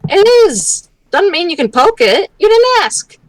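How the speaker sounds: put-on scolding the kid.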